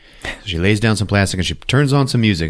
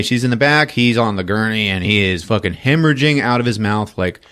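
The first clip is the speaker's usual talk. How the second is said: ordinary talk, nothing marked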